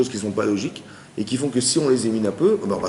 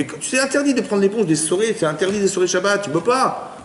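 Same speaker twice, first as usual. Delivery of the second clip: higher, urgent mock protest; lightly hammed-up pleading; retold insistence; 8 semitones higher than usual. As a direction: insist on the rule, pitched up. Rabbi retells his own protest.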